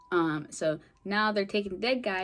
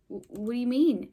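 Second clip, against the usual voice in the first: surprised; 5 semitones higher, 5 dB louder than usual. Retold or imitated. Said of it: "And he, he's like".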